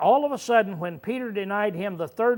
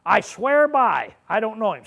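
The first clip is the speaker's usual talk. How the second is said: swearing an oath; 3 semitones higher, 5 dB louder than usual. Shown as a demonstration. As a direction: swear it like your life depends on it. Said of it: "what that means is".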